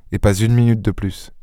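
ordinary delivery, nothing marked

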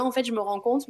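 ordinary talk

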